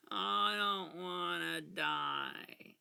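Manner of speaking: hammed-up dragging plea; mock dread. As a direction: wail it slowly like a doomed cartoon victim. Mock dread over a losing game.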